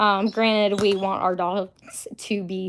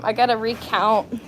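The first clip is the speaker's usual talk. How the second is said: ordinary delivery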